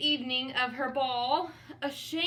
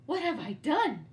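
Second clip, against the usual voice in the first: gasped; 4 semitones higher, 3 dB louder than usual. Acted. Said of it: "then gasped".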